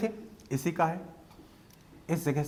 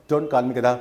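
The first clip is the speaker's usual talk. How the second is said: louder, firm comeback; firm demo retort; 3 semitones lower, 8 dB louder, slower than usual. Shown as a demonstration. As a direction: push back firmly on "गधा". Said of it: "तो आप तुरंत कर सकते हैं".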